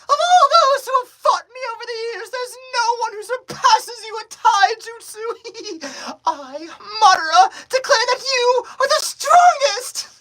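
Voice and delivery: very high, loud villain declaration; Madara. Acted. Madara praising an opponent's Taijutsu, high loud proclamation. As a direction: proclaim it high and loud, drawing out the last words.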